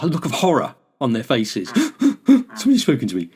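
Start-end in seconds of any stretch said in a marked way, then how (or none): 1.7-2.2 high, sharp mock gasp of horror; mock horrified gasp. Imitating a Londoner greeted by a stranger, mock horrified gasp. 2.3-3.3 quick, higher mock outrage; mock shocked Brit. Imitating a Londoner stunned a stranger spoke, quick mock shock.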